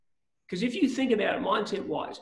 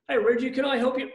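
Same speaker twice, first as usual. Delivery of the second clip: eager helper; 7 semitones higher, faster than usual. Retold or imitated. Offer of help acted out.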